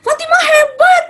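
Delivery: high, loud gushing cheer; over-the-top praise. Retold or imitated. Mother mocking the wrong way to praise a child, shrieking cheer. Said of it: "Jadi kita nggak gini".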